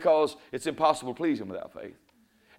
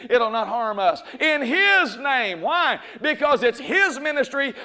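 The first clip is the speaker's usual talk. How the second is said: full-force preaching; 6 semitones higher, 3 dB louder than usual. Acted. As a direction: preach it hard with rising fervour, building to a shout. Preacher exhorting the congregation.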